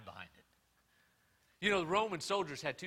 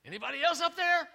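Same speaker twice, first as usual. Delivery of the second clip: high, louder, changed voice calling up; desperate punchline call; 10 dB louder than usual. Retold or imitated. Hanging man calling up for another helper, high and urgent. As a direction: cry out for anyone else, high and panicky.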